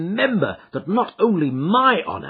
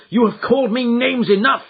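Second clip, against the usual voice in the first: higher, louder offended retort; wounded anger; 10 semitones higher, 6 dB louder than usual. Acted. Son answering his father's insults with hurt anger. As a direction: push back at the insults.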